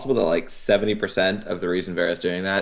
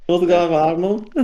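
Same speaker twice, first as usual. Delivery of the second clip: mock German; 7 semitones higher, 5 dB louder than usual. Mocking the fake German.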